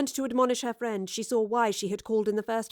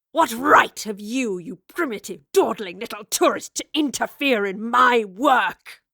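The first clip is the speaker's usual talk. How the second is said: furious; 6 dB louder than usual. Acted. Raleigh's slow furious tirade.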